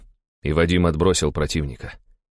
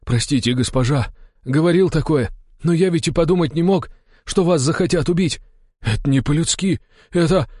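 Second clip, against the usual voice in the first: high, pleading, increasingly agitated; distressed apology; 11 semitones higher, 3 dB louder than usual. A narrator's reading. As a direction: stammer out an excuse to the lady. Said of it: "пробормотал он". (виновато, умоляюще)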